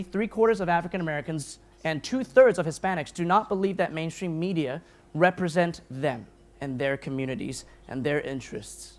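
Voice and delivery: ordinary talk, nothing marked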